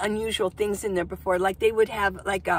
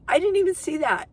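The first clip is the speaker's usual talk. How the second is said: surprised; 8 semitones higher, 5 dB louder than usual. Retold or imitated. Her surprised reply retold.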